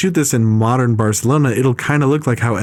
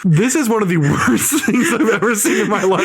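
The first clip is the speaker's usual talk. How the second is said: laughing; 7 semitones higher than usual. Real, not acted. Laughing through a rant.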